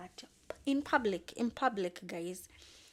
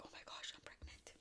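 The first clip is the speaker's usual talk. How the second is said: whispered, stunned; hushed disbelief; 15 dB quieter, faster than usual. Retold or imitated. Couple realizing she is pregnant, stunned whisper. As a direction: breathe it out in shock. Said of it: "and they're like".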